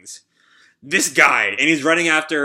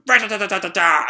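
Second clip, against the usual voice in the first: fast, higher rat-a-tat gun noise; gunfire imitation; 5 semitones higher, 3 dB louder, faster than usual. Speaker mimics the Gatling gun firing at Shiki, rapid rat-a-tat. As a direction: spit it out like a machine gun.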